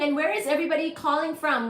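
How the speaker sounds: ordinary delivery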